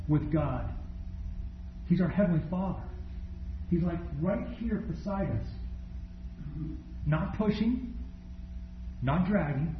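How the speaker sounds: quiet, tender sermon; intimate preaching. Acted. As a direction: draw them in close, gently.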